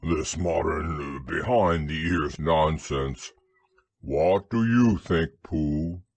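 low, slow grumble; grumbling Eeyore. A narrator's reading. Eeyore complains to Pooh about modern nonsense, grumbling low and slow. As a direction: drag it out in a low, disapproving voice. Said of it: "grumbled Eeyore".

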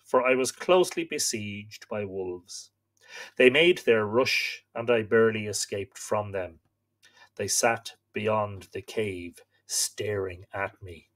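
slow, solemn storytelling read; story narration. A narrator's reading. Host reading myth to live viewers, solemn narration. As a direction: read the old myth aloud, grave and steady. Reads Irish myth aloud.

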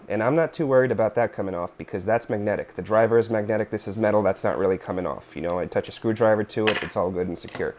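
usual speaking voice

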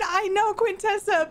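normal talking voice

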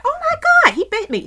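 high, loud re-enacted shock; alarmed, high-pitched outburst; shocked exclamation. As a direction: cry out in disbelief at being bitten. Retold shocked reaction to bite.

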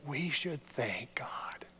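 whispered, slow and hushed; soft whispered thanks. Real, not acted. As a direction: breathe it out as a grateful aside. Quiet aside in sermon.

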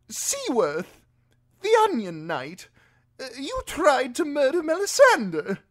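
high-pitched highborn lord, taken aback; Lord Alistair. A narrator's reading. Imprisoned lord recognises Davos the Onion Knight, taken aback. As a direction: question him in a high, loud aristocratic voice.

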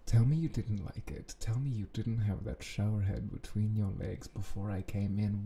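usual speaking voice

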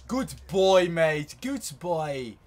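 put-on English "good boy" voice, starting loud; mock praise. Gamer to opponent who turned round, mock praise. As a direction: praise them like a dog, drawn out.

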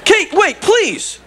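high, urgent appeal; pleading. Acted. Alf calls the wife back, pleading.

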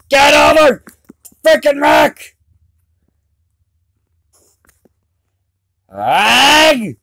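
furious shout; berserk rage. Real, not acted. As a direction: scream it in rage at the game. Rage at the game.

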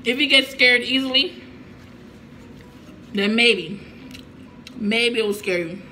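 speaking between bites; talking while chewing. Real, not acted. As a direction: mouth partly full of food. Seen 4: Fork to mouth at -1; lips work and napkin wipe while talking.

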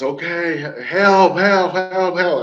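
high mock yell for help; retold screaming for help. Drowning swimmer yelling toward the island, screaming for help. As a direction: call out as if drowning, pitched well up. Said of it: "So I started screaming".

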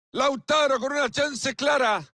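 rising excited play-by-play call; live goal commentary. Acted.

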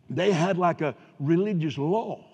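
ordinary talk, nothing marked

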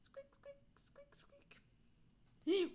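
small high-pitched peeps; mouse squeak. A narrator's reading. Imitating a little mouse.

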